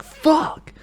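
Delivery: one loud, high-pitched burst of frustration; angry outburst. Acted. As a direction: explode on a single swear word. Imagined outburst of hateful anger.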